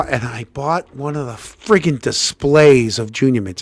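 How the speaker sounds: ordinary talk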